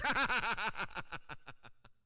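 big, loud derisive cackle, mimicked; mocking laugh. Listener scoffing at someone's shared dream, loud mock laughter. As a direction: imitate a long scoffing laugh that tails away. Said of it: "and they said".

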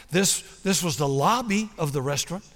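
usual speaking voice

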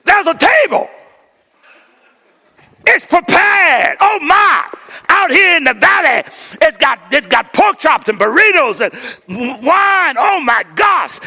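shouted high, overjoyed at the table; whooping delight at a feast; excited psalmist. Retold or imitated. Psalmist finding a table in the valley, shouting with joy. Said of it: "He goes".

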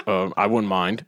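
low, deadpan mumble of a shy guest; mock Ward reply. Host mimicking Ward's bored one-word answer, low deadpan. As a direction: play it dry and unbothered.

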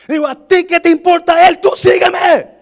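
very loud, high shout; full-volume mock scolding; acted forceful rebuke. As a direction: bellow it, stretching the final command. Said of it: "Así le dijo".

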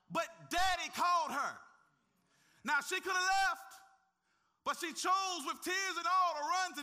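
loud, high, driving preacher's climax; preacher urging the church to run to God, fervent and loud; preaching at full force. Acted. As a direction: build the congregation up to a fervent peak.

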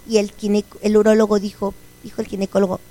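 ordinary talk

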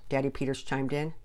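ordinary delivery

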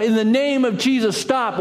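lone voice crying out against the crowd. Acted. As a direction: shout it down into the ring. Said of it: "And he cries out".

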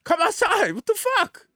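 high-pitched incredulous plea; retold cry to people holed up indoors; exasperated calling out. Visitor to L A residents chilling inside, exasperated. Retells urging people to come outside.

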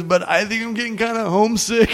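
high mock-tearful confession; homesick, near tears. Kid confessing to an older comic, mock near tears. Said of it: "And he just starts crying".

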